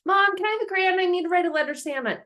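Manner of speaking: put-on high, quick little-kid voice; kid asking mom. Child asking mom for a crayon, high eager kid voice. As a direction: ask sweetly like an eager child. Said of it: "I'm gonna write a letter to Santa".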